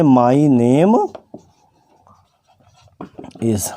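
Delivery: ordinary talk, nothing marked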